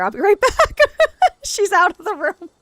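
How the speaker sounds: high, loud laughing words; laughing dash off. Real, not acted. Rushing off after baby, laughing.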